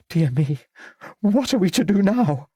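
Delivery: high-pitched, fretful question; flustered old lady. A narrator's reading. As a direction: fret aloud in a high lady's voice. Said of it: "asked Lady Cleves".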